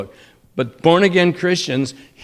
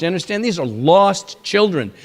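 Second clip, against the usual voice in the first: normal talking voice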